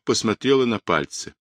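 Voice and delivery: ordinary delivery, nothing marked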